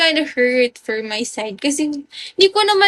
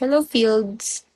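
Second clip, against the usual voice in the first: ordinary talk, nothing marked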